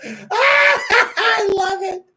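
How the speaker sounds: slow, high, loud laughing shout; cackling delight. Real, not acted. Gloating over Lopez voted out.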